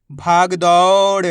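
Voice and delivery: "भाग दौड़" joined up, slow and loud; blended word, drawn out. Shown as a demonstration. Teacher joins the letters for learners, slow blended word. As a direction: stretch the vowels of "भाग दौड़" as one word. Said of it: "तो मिलके बन जाएगा".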